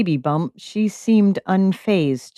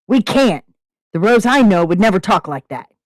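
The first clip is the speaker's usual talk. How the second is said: raised voice, near a shout; yelling; 4 semitones higher, 5 dB louder than usual. Acted. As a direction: shout it at them. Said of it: "Derek yelled".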